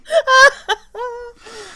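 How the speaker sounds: loud, high-pitched cackling laugh; real leg-slapping burst of laughter; helpless cackle. Real, not acted. Host laughing at co-host's lost game, helpless cackle. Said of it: "You're almost slapping your leg".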